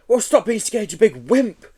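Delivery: high exasperated snap; snapping. Acted. Joe snapping at the scared Yeti, high exasperated. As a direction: snap at him. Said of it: "Joe snapped".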